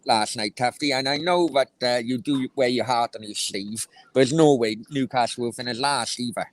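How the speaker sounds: ordinary talk, nothing marked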